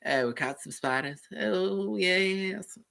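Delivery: high put-on cartoon voice, drawn out at the end; Family Guy character. Streamer imitating that character from Family Guy, high cartoon voice. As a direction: climb into a high, cartoonish impression. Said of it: "that character from Family Guy".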